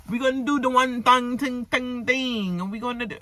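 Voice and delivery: a lightly hammed-up Jamaican patois quote; imitating Jamaican patois. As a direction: mimic a sister's Jamaican patois, half-laughing. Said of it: "let me stop lying".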